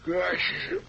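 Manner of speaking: sleepy mumbles rising into a high question; just roused, slowly coming to; acted waking-up grogginess; groggy waking.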